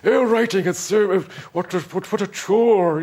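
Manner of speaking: high, loud, hammed-up moaning; mock complaining writer. Writer mocking others who moan about writing, hammed-up complaint. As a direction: imitate a precious writer bemoaning the toil. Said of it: "when I hear people say".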